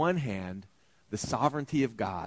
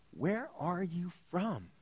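a soft, slow, higher-pitched question; hushed question; 5 semitones higher, 8 dB quieter, slower than usual. Acted. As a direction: ask it quietly and slowly, unsettled. Pilate quietly asks Jesus.

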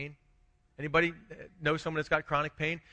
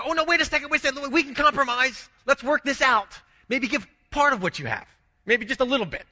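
higher and louder, a hammed-up begging plea; mock pleading Jesus; 8 semitones higher, 5 dB louder than usual. Preacher mocks Jesus begging the rich ruler back, hammed-up pleading. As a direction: play a Jesus caving in, pleading after the man.

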